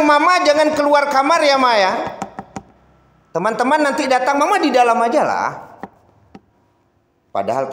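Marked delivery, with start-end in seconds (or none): 0.0-5.5 put-on high, pleading child to mother; lightly voiced child. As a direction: coax mama to stay inside. (anak membujuk mama dengan nada tinggi)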